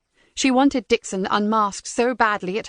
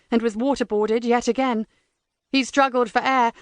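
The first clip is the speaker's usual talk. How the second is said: ordinary delivery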